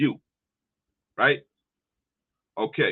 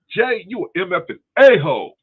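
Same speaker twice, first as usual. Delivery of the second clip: much louder and higher, aggressive insult; hostile insult; 9 semitones higher, 9 dB louder than usual. Shown as a demonstration. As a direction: spit it out like picking a fight. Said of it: "If I say".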